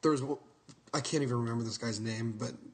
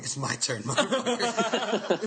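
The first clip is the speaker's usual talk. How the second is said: cackling as you gloat over your turn; laughing taunt; 6 semitones higher, slower than usual. Retold or imitated. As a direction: laugh through a slow, drawn-out mock taunt. Retold taunt before punching.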